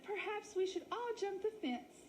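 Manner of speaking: high, fluttery dramatic lady; milk the sad lost story; wolf faking a sheep lady. Acted. Wally suggests leaping.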